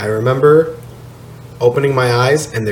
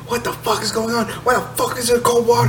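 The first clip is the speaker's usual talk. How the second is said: retold yelling; 10 semitones higher, 4 dB quieter, faster than usual. Man waking in a cold shower, high mock yelling. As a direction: squeal the complaint as a held-back yell. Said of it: "I was yelling at everybody".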